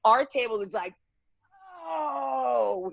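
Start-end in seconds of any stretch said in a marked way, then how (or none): 1.8-2.9 mock shocked scream; imitated collective scream. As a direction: mimic a table screaming, held back in volume. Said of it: "we literally all scream collectively".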